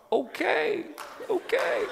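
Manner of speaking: very high, hesitant humoring tone; indulging an overexcited friend; skeptical okay. Retold or imitated. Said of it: "And they're like".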